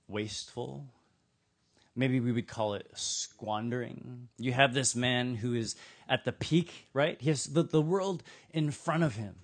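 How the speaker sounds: ordinary talk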